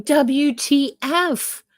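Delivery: high, drawn-out mock shock; a stung character's stretched-out protest; outraged disbelief. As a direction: spell it out slowly in acted indignation. Stung frog's outrage in story.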